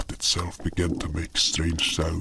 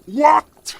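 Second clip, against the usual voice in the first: shrill high alarmed question; alarmed what; 8 dB louder than usual. Acted. Patient alarmed about a swelling leg, loud high shriek.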